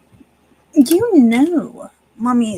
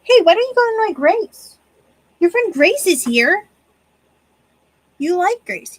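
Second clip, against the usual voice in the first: higher, louder sing-song to the cat; coaxing pet talk; 7 semitones higher, 5 dB louder than usual. Real, not acted. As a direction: shoo him playfully toward Grace. Coaxing cat toward friend.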